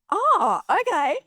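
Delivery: very high, surprised realisation; high-pitched "oh, I see" reaction; taken aback. Retold or imitated. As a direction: re-enact a parent suddenly caught out. Mum taken aback by confession.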